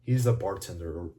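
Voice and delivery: ordinary talk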